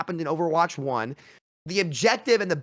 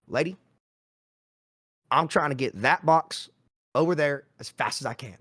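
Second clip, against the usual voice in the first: put-on Arkansas accent; 2 semitones lower than usual. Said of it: "that's my Arkansas accent".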